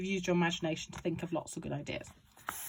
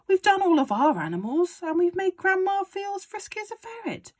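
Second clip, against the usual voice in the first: put-on high, questioning boy; lightly voiced boy George; 13 semitones higher, 7 dB louder than usual. George asking his father why they need more, lightly voiced. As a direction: ask like a puzzled son.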